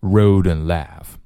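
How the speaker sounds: slow "road and laugh" with and cut to n; drawn-out "road and laugh", linked through a bare n; reduced "and". Shown as a demonstration. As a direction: link "road and laugh" slowly, just an n for and. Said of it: "这个and只剩一个嗯".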